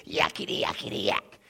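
lightly voiced demon yakking, hushed; imitating a little demon's babble; a hint of a shrill demon jabbering; mock demon chatter. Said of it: "crying in a shrill voice".

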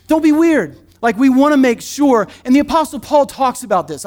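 ordinary delivery

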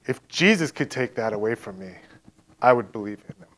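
low, soft, subdued reply; retold guarded admission. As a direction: concede it quietly, imitating a tough man.

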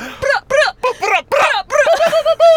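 high, loud volley of "bruh" sounds; hype-man noises. Pretend. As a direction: fire off hype-man noises, building to a shout. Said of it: "I feel kinda like your hype man".

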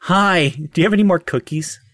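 eager gnome. Acted. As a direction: ask for cookies like a child.

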